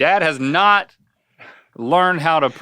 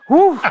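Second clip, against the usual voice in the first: high loud whoop; celebratory woo; woo cheer; 7 dB louder than usual. Real, not acted. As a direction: whoop for joy.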